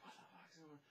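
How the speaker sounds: imitating coworkers in a high whisper; bewildered whisper. Voices confused coworkers running around.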